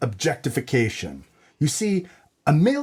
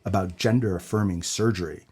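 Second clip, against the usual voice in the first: noir detective narration; 3 semitones lower than usual. Acted.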